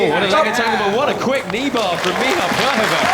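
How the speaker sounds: loud, high thrilled commentator; excited commentary. Real, not acted. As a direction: burst out with excitement. Commentator reacts to submission.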